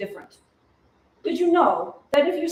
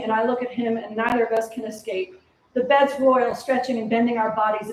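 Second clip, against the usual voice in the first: ordinary talk, nothing marked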